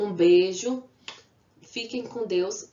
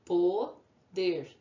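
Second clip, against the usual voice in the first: Poder split evenly into Po, then der; syllable by syllable. Shown as a demonstration. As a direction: say Po, pause, der at normal volume. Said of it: "Duas sílabas".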